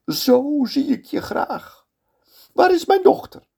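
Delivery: high bitter lament of the sultan. Acted. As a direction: brood like a betrayed old father. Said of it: "zegt de sultan".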